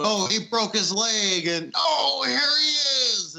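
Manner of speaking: high excited fan gasp; mock gasping fan. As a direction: squeal like a gullible kid watching. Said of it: "I remember being so oblivious".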